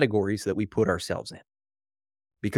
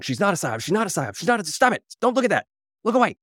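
a denial that spirals into hurried shushing; mock frantic denial; 8 semitones higher, faster than usual. Mocking frantic media denial.

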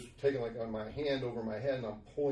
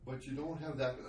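usual speaking voice

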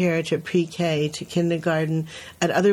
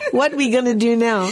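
laughing while quoting; 4 semitones higher, 3 dB louder than usual. Retold or imitated.

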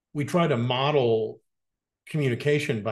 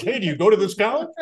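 high, fast, hammed-up pickup line; cheesy pickup line; 9 semitones higher, 4 dB louder, faster than usual.

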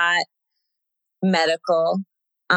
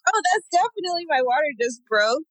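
high, startled realization; suddenly realizing her water broke; 10 semitones higher, faster than usual. Retold or imitated. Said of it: "And I was like".

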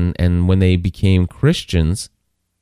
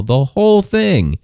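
higher, insistent emphasis; emphatic; 9 semitones higher than usual. Real, not acted. Preacher insisting the law means everything, higher and emphatic. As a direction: drive home the word everything.

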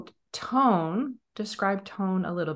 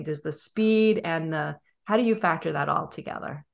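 usual speaking voice

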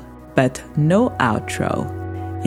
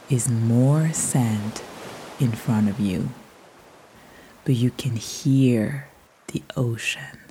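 guided meditation; 4 dB quieter than usual. Acted. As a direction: paint the beach softly, drifting. Guided beach visualization.

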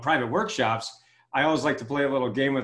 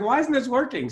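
frustrated; 9 semitones higher than usual. Retold or imitated. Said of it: "I was so frustrated".

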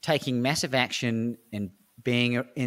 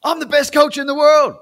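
loud triumphant shout; 10 dB louder than usual. Retold or imitated. Coach proclaims being the best.

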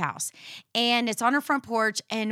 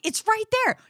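exasperated; 12 semitones higher than usual. Retold or imitated. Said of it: "I'm like".

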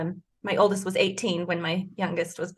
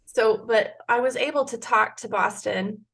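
usual speaking voice

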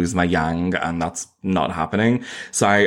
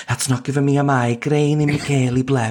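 put-on 1 Scouse accent; 4 semitones higher than usual. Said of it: "do Scouse with me".

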